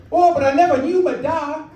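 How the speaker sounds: loud, high Geordie lament; Luke Skywalker. Acted. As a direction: wail it as a hammed-up Geordie son. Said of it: "That's why I made him Geordie".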